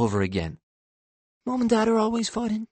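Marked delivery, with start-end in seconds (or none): 1.5-2.7 high, quick little-boy voice, hint of a secret; confiding child. A narrator's reading. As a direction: confide it lightly as a small boy. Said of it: "Remington whispers to me confidentially".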